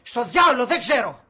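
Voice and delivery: loud, exasperated retort; angry outburst. Acted. Man evicting housemate who asks where to go, angry. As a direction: throw it back at him loudly.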